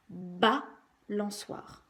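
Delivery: "balançoire" with BA held apart, then lançoire; stress on first syllable. Shown as a demonstration. Teacher showing parents how to insist, "balançoire" as BA... lançoire. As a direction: lean on the BA of "balançoire", pause, finish the word. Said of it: "Bien insister sur la première syllabe".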